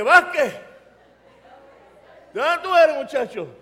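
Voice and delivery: loud, high surprised hail; re-enacted shout of recognition; excited calling out. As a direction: hail a fellow countryman eagerly. Calls out to sergeant on seeing name.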